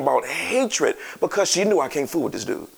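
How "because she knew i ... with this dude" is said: usual speaking voice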